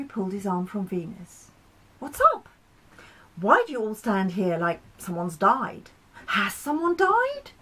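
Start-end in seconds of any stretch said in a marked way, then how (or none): 2.0-7.5 puzzled Mercury. Acted. Mercury arrives and finds gloom, bluntly surprised. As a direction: ask straight out, baffled.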